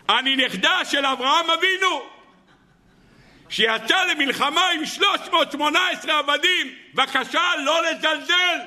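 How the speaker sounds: put-on high, pleading old grandmother; high indignant granny insisting on her ancestry; old woman's indignant voice. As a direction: play the offended old lady demanding respect.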